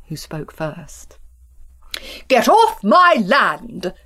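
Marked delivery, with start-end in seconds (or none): 2.3-3.9 slow loud farmer's shout. Acted. Farmer Town yelling at his neighbour, loud and slow. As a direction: bellow it at the trespasser. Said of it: "he shouted".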